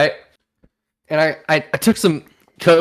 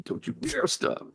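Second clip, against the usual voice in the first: hushed, urgent whisper, higher pitched; whispered taunt; 9 semitones higher, 15 dB quieter than usual. Pretend. Whispering to a friend to keep cleaning, hushed dare. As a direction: breathe it like a dare.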